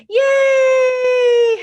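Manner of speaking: high loud happy cheer. Acted. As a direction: cheer big for the kids. Cheering after song.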